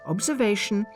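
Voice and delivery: ordinary talk, nothing marked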